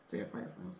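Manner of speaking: whisper. Real, not acted. Mother asking viewers to pray for the boy, whispering. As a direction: breathe it out as a soft whisper. Closing plea for dead child.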